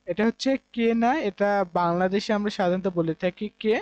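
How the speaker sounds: normal talking voice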